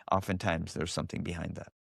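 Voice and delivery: ordinary talk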